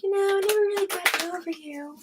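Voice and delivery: hammed-up confession to an ex; playfully imitating a mushy ex-confession; mock sappy confession. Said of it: "you're like".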